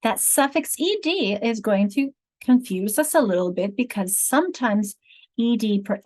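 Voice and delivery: ordinary delivery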